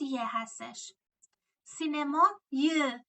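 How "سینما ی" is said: سینما ی stretched very slowly; teacher slows down to show the link; slow demo. Shown as a demonstration. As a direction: draw out the ی link. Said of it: "slow demo of the ی link".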